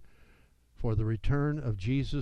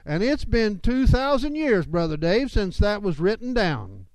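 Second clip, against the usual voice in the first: mock skeptical critic; 6 semitones higher, 3 dB louder than usual. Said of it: "a lot of critics who will say".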